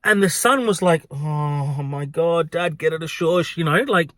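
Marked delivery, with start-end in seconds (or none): 1.1-3.6 slow, drawn-out embarrassed groan. Retold or imitated. Son to his dad during a scene, embarrassed. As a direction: imitate a mortified son hushing his parent. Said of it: "he was embarrassed".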